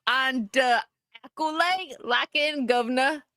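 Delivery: piratey, badly faked Cockney; bad Cockney accent. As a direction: stumble through a slow mock Cockney. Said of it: "put on a Cockney accent".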